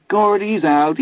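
high cackling giggle, shouted; cackling taunt. Acted. Teddy gloating over the card game with a high cackle. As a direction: crow it in a shrill mocking cackle.

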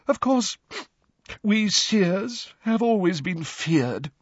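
slow, high mystic lament; stretched-out theatrical self-pity of a seer; dramatic, wavering. A narrator's reading. Professor Trelawney lamenting the fate of seers, dramatic. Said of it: "dramatic, wavering voice".

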